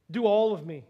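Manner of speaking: loud, pleading burst; eager outburst. Acted. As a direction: throw yourself at him, all in. Peter's plea bursts out.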